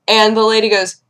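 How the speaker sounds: usual speaking voice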